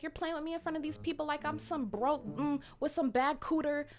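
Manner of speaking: high-pitched imitation of her protest; retold upset complaint. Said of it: "begins to get very upset".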